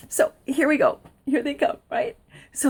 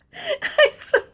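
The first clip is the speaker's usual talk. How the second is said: laughing. Real, not acted. Laughs at the sheriffs.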